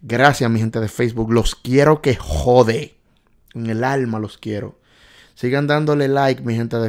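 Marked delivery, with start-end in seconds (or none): 1.3-3.0 slow and louder; emphatic. Real, not acted. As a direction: lean hard on every word. Emphatic love for Facebook fans.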